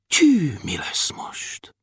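slow, hushed worry; dismayed whisper. A narrator's reading. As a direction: whisper it slowly, at a loss. Dwarfs stranded at the station. (lassú, tanácstalan suttogás)

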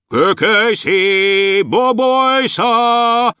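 high, long-drawn calling; shouting from a tower. A narrator's reading. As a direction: stretch the names out, calling from afar. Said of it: "Kiabálta a fejedelem is a toronyból". (magasan, hosszan elnyújtott kiáltás)

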